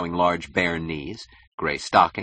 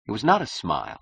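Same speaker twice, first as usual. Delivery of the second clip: plain reading voice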